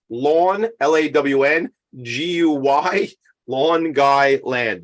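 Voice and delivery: "Lawn Guy Land" spelled out, slow, each syllable separate; teacher's Long Island. Retold or imitated. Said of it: "the way I had a teacher in high school".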